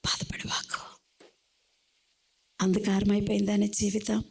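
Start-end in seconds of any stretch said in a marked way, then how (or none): 0.1-1.0 whisper. Acted. Sermon opens softly.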